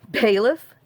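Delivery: surprised "Bailiff?" with a sharp rising tune; incredulous. Retold or imitated. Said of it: "Just like that".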